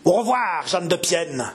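high-pitched, menacing farewell; growled threat. A narrator's reading. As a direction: throw the goodbye like a threat. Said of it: "gronda-t-il".

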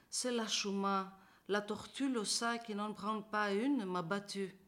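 plain reading voice

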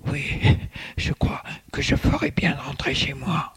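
put-on breathy whispered old man; short of breath, barely voiced; breathless old person. A narrator's reading. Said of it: "Le vieil homme est tout essoufflé".

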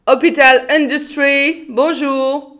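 hospital operator greeting like a flight attendant; air hostess voice. Acted. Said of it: "parlait comme une hôtesse de l'air".

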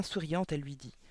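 usual speaking voice

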